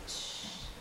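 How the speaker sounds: soft, voiceless shush. Acted. Crowd hushing blind Bartimaeus, shushing. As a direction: hush them with a long shh. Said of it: "people would shush him".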